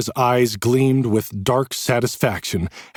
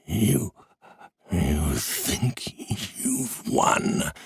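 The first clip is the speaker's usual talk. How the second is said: very slow, soft, raspy menace; rasp; 3 semitones lower, 6 dB quieter, much slower than usual. A narrator's reading. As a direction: rasp it with malice. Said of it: "His voice was a rasp".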